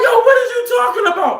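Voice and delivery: fast, high indignant shout; retold heated comeback; matching his hollering. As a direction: fire it back high and fast. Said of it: "I'm matching his energy".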